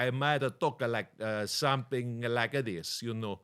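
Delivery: slow put-on Italian accent; Italian-leaning accent. Shown as a demonstration. Guest tries Greek for the host, comes out Italian-accented. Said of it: "That's more Italian".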